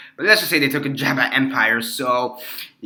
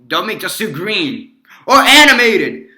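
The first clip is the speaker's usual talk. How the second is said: higher, louder, drawn-out plea; mock outcry; 8 semitones higher, 7 dB louder than usual. Quoting the film's jab at DC, mock outcry. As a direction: cry it out slowly, hammed-up. Acted film quote, protesting.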